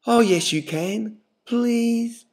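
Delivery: high pleading please; begging. A narrator's reading. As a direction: wheedle her into it. Guy begging.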